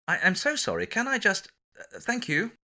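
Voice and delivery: put-on apologetic English accent pushing through a crowd; best English accent. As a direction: squeeze past saying sorry in an English accent. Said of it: "apologising in my best English accent".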